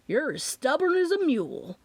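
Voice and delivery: high, fond grumble of a father; muttered. A narrator's reading. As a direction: grumble it with a hidden smile. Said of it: "Daddy muttered".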